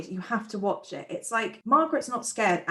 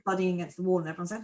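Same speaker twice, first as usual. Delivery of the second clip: ordinary talk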